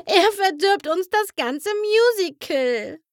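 high, wailing whine; wailing complaint. Acted. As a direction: wail it like a pony on the verge of tears. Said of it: "heulte Flitter".

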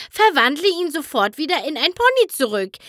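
high, fast and insistent; a filly ordering Discord to fix her brother; angry demand. A narrator's reading. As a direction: stamp your hooves as you demand it. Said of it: "stampfte mit den Hufen auf".

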